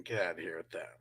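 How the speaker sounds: dismissive whisper to himself. Real, not acted. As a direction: mutter it under your breath. Muttering at a bad trade offer.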